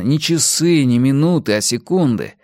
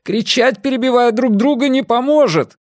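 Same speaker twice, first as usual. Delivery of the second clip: high-pitched urgent yell; shouting; 13 semitones higher, 4 dB louder than usual. A narrator's reading. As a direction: shout down the others. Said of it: "закричал Эрик".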